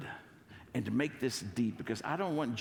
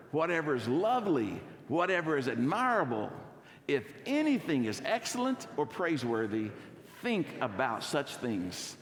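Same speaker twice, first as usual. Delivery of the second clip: joyful recitation; 5 semitones higher than usual. Shown as a demonstration. Pastor leads congregation in a memory verse, joyful and emphatic. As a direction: recite it with expression, like you mean it. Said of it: "gonna say this with joy".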